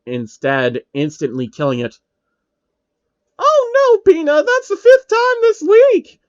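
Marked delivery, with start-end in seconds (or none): 3.4-6.2 put-on high wailing girl voice, much higher; a girl sobbing over her dead dragon; shrill crying wail, much higher and louder. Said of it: "the girl cries".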